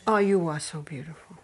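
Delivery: put-on low whispered baby praise; cooing to baby. Giving the baby the attention he wants, whispered cooing. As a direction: whisper it adoringly to an infant. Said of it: "what does that baby want".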